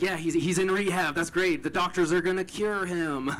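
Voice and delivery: lightly mocking upbeat voice; mock-hopeful cheer. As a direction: sound sure that rehab fixes everything. Relief brother went to rehab.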